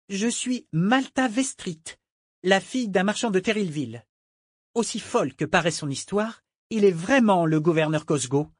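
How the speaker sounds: high-pitched, defiant female character; proud young woman. Acted. Merchant's daughter vouching for the governor, defiant. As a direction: declare yourself in a high, insistent voice. Malta asserts who she is.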